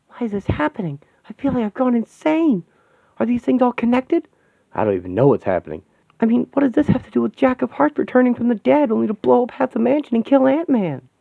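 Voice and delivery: a panicked hero who can't believe this; panicked high character voice. Acted. A hero panics as the robots attack, high and frantic.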